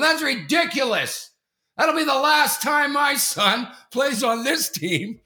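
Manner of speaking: high, loud, slow furious shout; furious outburst. Retold or imitated. Dad shouts at the coaches while his son drags him out. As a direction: storm out, shouting the final verdict. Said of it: "I embarrassed my kid so much".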